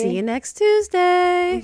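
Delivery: high, slow, sing-song teasing goodbye; drawn out with a wink; cheeky sign-off. Acted. Co-host teasing viewers at sign-off, high sing-song voice.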